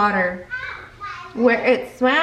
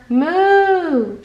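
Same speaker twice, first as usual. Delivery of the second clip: loud cow moo, pitched up; shouted moo; 5 semitones higher, 5 dB louder than usual. A narrator's reading. Cow bursting into the knock-knock joke, loud moo. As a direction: belt out the moo. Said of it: "shouted Interrupting Cow".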